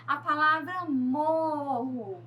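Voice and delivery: morro said slowly, first syllable leaned on; teacher drawing out the stressed syllable. Shown as a demonstration. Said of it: "A sílaba mais forte".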